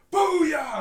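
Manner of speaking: sudden loud high "Booyah!" yell; retold shout. Coworker cheering at her desk, loud high yell. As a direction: belt it out like a cheering fan. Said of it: "and she'd be like".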